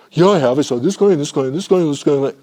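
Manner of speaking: a hint of a protesting employee listing clients; mock employee. Employee defends herself in supervision.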